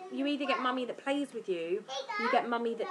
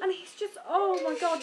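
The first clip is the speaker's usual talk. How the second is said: ordinary delivery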